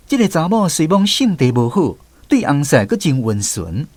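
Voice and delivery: normal talking voice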